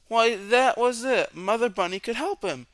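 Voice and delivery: excited realization. A narrator's reading. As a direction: savor the discovery. Idea confirmed.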